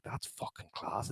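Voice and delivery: whispered in awe; awed whisper. Retold or imitated. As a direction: breathe it out, impressed. Retelling his own reaction to news.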